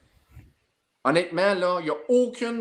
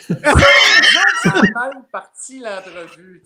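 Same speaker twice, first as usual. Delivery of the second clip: laughing loud outburst; 5 semitones higher, 14 dB louder, slower than usual. Real, not acted. Laughing recap of failed interview.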